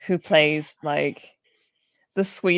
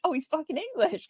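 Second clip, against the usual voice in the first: high, softer stunned aside; retold surprise, raised pitch; mock realization; 10 semitones higher, 6 dB quieter than usual. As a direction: replay the dawning shock. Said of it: "And I was like".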